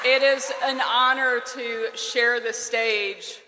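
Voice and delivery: ordinary delivery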